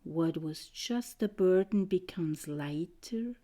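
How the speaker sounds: ordinary talk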